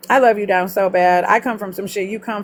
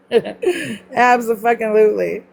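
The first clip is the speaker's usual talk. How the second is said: ordinary talk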